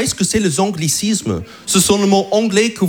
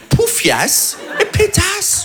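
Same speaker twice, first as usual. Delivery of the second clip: mock insult; 11 semitones higher, much slower than usual. Listing insults in a put-on voice.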